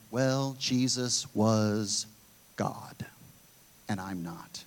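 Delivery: low, very slow, fading pushback excuse; mock pushback. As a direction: drag it out low and trail off to nearly nothing. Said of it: "the pushback that you hear".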